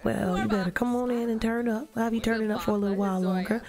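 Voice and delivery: ordinary talk, nothing marked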